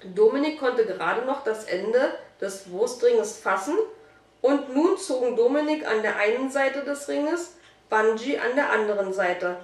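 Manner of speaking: ordinary delivery, nothing marked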